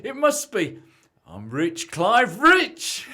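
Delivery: high, loud shout of joy; overjoyed. Acted. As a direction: whoop about being rich. Celebrating the lottery win.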